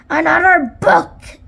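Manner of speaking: pirate captain's exasperated shout; roaring. A narrator's reading. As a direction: roar in disbelief. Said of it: "roared Captain Bones".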